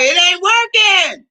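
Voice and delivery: high, slow it ain't working; deadpan complaint. Retold or imitated. To the excited mechanic, fed up, high and slow. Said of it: "I'm like".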